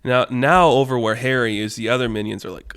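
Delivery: ordinary delivery, nothing marked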